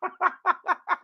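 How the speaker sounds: high, breathy burst of laughter; high giggle. Real, not acted. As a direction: crack up at the clip. Laughing at funny clip.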